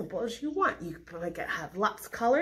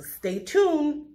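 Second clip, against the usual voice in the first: drawn out; 7 semitones higher, 3 dB louder than usual. Acted. Teasing the upcoming song.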